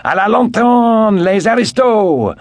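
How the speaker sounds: high, slow, loud howl of a mob. Retold or imitated. The Paris mob howling at the barricade, loud and high. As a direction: howl it like a bloodthirsty crowd. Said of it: "whilst the mob howled".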